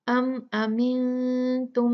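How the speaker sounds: slow nasal hum held on the म of अम अमिन तुम; ghunna demo. Shown as a demonstration. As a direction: hum the doubled म through the nose, slowly. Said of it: "अच्छे से गुन्ना होगा".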